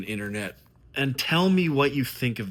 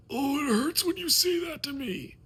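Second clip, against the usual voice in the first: much higher, whiny mock-wounded voice; mock hurt whine; 12 semitones higher than usual. Mocking a partner who takes his honesty as hurt, in a high whiny voice. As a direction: whimper it like a wounded drama queen.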